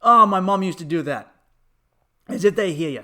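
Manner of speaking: ordinary talk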